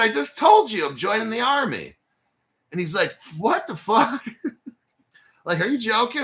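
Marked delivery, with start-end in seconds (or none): 0.0-2.0 raised, louder insistence; telling his friend for the third time he's joining the army, loud and exasperated; exasperated shout. Retold or imitated. To a friend who won't believe him, an exasperated retold shout. 3.4-6.2 high, laughing what the fuck; voicing the friend cracking up in disbelief. Friend hearing he's joining the army, high laughing disbelief.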